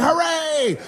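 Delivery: high shout of hooray; mock crowd hooray; crowd cheer. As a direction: cheer like a mob.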